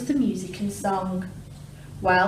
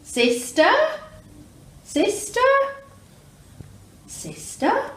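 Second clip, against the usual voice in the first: high echo fading away; 13 semitones higher, 4 dB louder, slower than usual. Acted. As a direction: echo answering from the cave. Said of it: "a voice from the cave came back".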